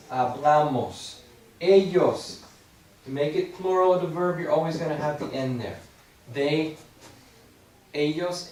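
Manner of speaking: ordinary delivery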